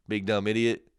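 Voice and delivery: usual speaking voice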